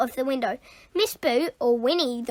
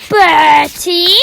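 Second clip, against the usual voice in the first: loud yell of his name; 3 semitones higher, 12 dB louder than usual. Acted. Teacher yelling after fleeing pupil, loud yell. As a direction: shout after him. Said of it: "yelled Miss Boot".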